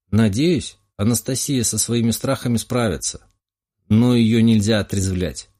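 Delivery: ordinary delivery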